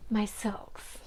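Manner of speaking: hushed whisper over the silks. Pretend. Fibre lover whispering to viewers about her silks. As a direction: breathe the words like a secret.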